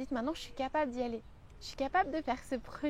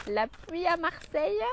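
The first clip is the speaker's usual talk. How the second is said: put-on slow, playful Marseille accent, a bit higher; teasing Marseille accent, slow; mock Marseille accent; 4 semitones higher, slower than usual. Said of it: "je sors avec mon accent".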